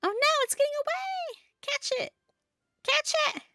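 very high squealing cartoon alarm; panicked game henchman. Henchmen panicking as beetle escapes.

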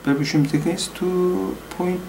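usual speaking voice

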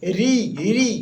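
"রি" pronounced "ri" in place of "re", each one set apart; teacher demonstrating "রি" as a short, separate "ri"; komal re pronunciation. Shown as a demonstration. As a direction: say "রি" with an i vowel, as "ri". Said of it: "রে কোমলের উচ্চারণ কি".

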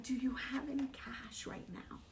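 hushed, breathy retold ask; quoting a desperate stranger in a whisper; whispered plea. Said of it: "and she's like".

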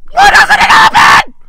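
high, loud squealed shout; panicked screech. Real, not acted. Player at a door that won't open, panicked screech. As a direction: screech it in desperation.

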